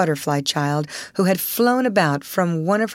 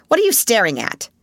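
fast, higher, louder demand; sharply demanding; 5 semitones higher, 4 dB louder, faster than usual. Acted. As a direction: snap the question at her. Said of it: "demanded Miss Minchin sharply".

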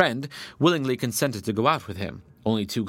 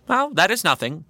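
higher and louder, airy dismissal; sly rabbit; 9 semitones higher, 6 dB louder than usual. A narrator's reading. Rabbit fooling the badger, airy dismissal. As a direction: brush it off lightly. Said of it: "replied the rabbit".

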